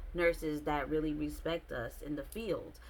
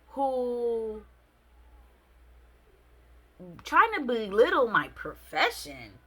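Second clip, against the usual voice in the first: louder, slower indignant rant; 3 semitones higher, 6 dB louder, slower than usual. Retold or imitated. Telling the date off for belittling her job, indignant and loud. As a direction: demand an answer, offended.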